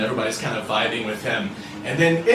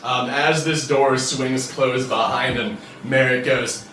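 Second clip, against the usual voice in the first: ordinary talk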